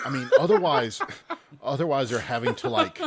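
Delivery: loud, laughing through the words. Real, not acted. Laughing while talking.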